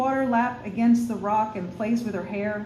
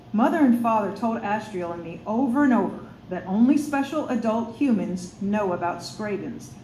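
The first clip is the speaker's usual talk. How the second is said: plain reading voice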